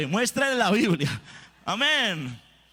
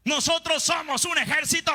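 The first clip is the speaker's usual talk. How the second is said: a full-voiced battle cry, much higher; preacher's shout; 7 semitones higher than usual. Real, not acted. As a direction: shout it to rouse the congregation.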